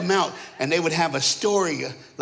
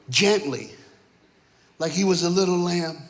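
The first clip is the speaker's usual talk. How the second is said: ordinary talk, nothing marked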